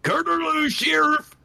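high, hammed-up macho voice; Kurt Angle impression. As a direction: ham up a macho action hero. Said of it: "you don't say, Kurt Angle".

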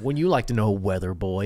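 imitating a smug kid on the news; sassy kid quote. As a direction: deliver the viral kid's smug comeback. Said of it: "He's like, like".

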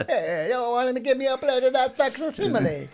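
put-on jokey German accent, loud and high. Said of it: "See, that was my, uh, German accent".

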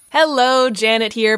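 ordinary talk, nothing marked

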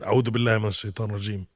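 fast Arabic recitation; a hurried recitation; rushed protective prayer. Shown as a demonstration. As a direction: rattle it off quickly. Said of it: "you translate".

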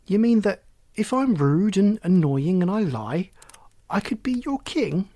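thoughtful. Acted. Boy checks the frog's offer to make him king, thoughtful. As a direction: work it out aloud, pitch lifted. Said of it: "Oliver sounded thoughtful".

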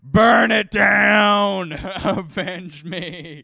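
slow, very loud, high anguished yell; drunk dad yelling. Pretend. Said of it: "really strong drunk dad vibes".

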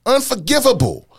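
escalated Unforgivable. Shown as a demonstration. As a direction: push the word Unforgivable up with outrage.

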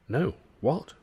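usual speaking voice

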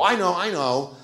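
high, know-it-all eagerness; mimicked eager fan. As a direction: imitate someone bursting with the answer. Mimics eager fans offering theories.

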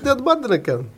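high mock Polish accent. Builder repeating himself to a baffled client, in a mock Polish accent. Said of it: "to do the accent".

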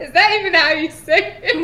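high, loud, breaking into laughter; laughing question. Real, not acted. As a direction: ask through helpless laughter. Cracking up at own pronunciation.

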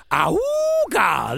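sudden high yelp; high shout. Acted. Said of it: "Trying to wake myself".

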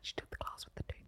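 hushed dramatic whisper; breathless whispered confession. Real, not acted. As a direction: whisper it like a tragic secret. Tragic confession of lost tooth.

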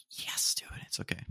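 hushed, whispery reassurance; whispered aside. Real, not acted. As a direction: drop to a soft whisper. Aside about loud roommates in background.

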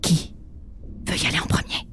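tense hushed whisper. Acted. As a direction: whisper under tension. Whispering in hiding. (chuchotement tendu)

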